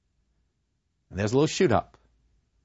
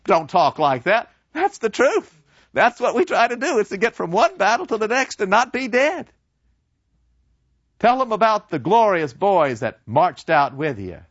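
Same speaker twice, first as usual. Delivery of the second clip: high, loud, indignant teacher; scolding professor; 10 semitones higher, 5 dB louder than usual. Acted. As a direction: brush off the bad news, loudly.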